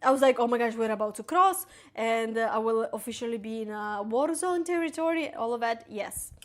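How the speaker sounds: ordinary talk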